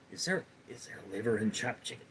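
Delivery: very soft, quick attempt at a cartoon boy; half-hearted cartoon kid impression, barely voiced; Bobby Hill. Streamer unsure they can do Bobby, hushed tentative impression. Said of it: "I can't do Bobby".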